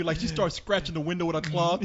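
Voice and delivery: normal talking voice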